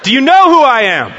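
loud, high ringing question; loud challenge. Acted. Preacher booming the coach's question at the congregation, loud. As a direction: throw the question out to the room.

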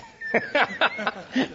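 a bright rising laugh; high laugh. Real, not acted. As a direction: laugh at the volunteer's answer. Laughing at the exchange.

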